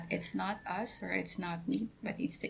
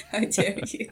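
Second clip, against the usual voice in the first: playful fake indignation, a bit louder; mock outrage; 2 semitones higher, 7 dB louder than usual. Joking offense at the Godly [name] nickname. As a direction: pretend to be offended, grinning.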